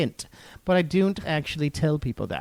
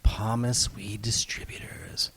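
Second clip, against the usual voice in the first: mock commercial; 5 semitones lower than usual. Host recording a fake weed sponsor spot, hushed commercial voice. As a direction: purr it like a spoof late-night ad. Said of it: "Shall we record another commercial?".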